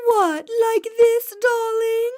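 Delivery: put-on high, slow, plummy old-lady voice; mock-posh aristocratic lady, drawn out; posh uptight old lady. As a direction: put on a haughty lady of the manor. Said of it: "as posh and high-pitched as I can, trying my utmost to sound like an uptight old lady".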